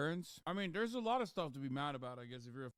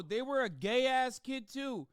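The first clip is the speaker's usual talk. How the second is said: ordinary talk, nothing marked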